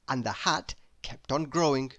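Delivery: usual speaking voice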